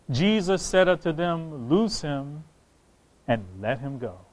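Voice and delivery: low, soft, solemn Bible reading; hushed pulpit reading; scripture reading. Acted. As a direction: read the verse out quietly and deliberately. Reads scripture aloud.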